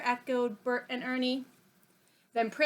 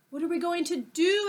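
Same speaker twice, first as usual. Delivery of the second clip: worried; 7 semitones higher than usual. Acted. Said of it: "asked Kippy worriedly".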